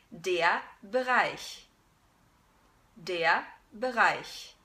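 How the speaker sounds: slow Bereich, hiss the ch; German Bereich. Shown as a demonstration. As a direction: Bereich with ich sound.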